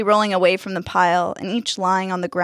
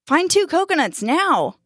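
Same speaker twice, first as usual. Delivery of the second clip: urgent order; 9 semitones higher than usual. A narrator's reading. Jack urgently orders Dustin.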